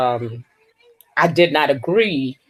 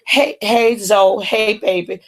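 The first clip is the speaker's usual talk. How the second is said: excited greeting; 5 semitones higher than usual. Real, not acted. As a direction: call out happily to a new arrival. Greeting viewers joining live stream.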